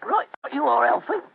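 put-on Cockney mate; Cockney accent. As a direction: answer as an obliging Cockney sidekick. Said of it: "My Cockney accent doesn't compare with yours".

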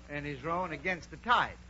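usual speaking voice

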